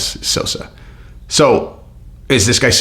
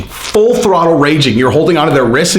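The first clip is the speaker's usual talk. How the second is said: ordinary delivery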